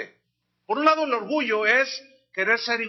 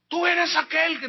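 high, louder eager asking; questioner; 8 semitones higher, 5 dB louder than usual. Acted. Crowd asking John, a higher, louder eager question. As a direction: press him with the question.

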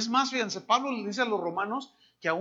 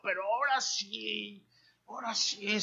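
lightly voiced sheepish promise, softer; mock repentance; 8 dB quieter than usual. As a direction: play a half-hearted repentant churchgoer. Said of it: "algunos de ustedes están ahí". (feligrés arrepentido a medias, en voz baja)

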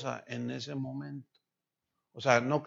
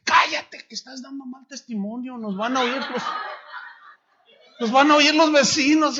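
loud, high hushing snarl; angry husband; 8 semitones higher, 8 dB louder than usual. Retold or imitated. Abusive deacon silences his wife about the neighbours, angry shout. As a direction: hiss him down then rise to a shout. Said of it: "Entonces le dice".

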